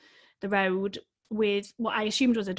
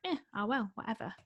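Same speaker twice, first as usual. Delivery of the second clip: mock indifference; 9 dB quieter than usual. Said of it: "Everyone's a bit like".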